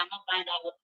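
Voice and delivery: normal talking voice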